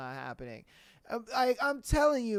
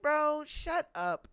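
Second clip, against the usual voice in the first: dismissive retort; 7 semitones higher, much slower than usual. Retold or imitated. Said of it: "you're just like".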